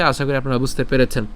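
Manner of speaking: ordinary delivery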